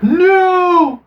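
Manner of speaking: high, loud dramatic bellow; mock shout. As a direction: third hammed-up hero yell. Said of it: "Morpheus again shouts to the third".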